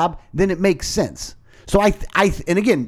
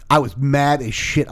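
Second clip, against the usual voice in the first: usual speaking voice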